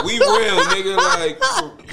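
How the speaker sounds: squeaky, loud, high ghost voice boasting it is real; mock ghost bragging to the dad. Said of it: "the ghosts would come tell me".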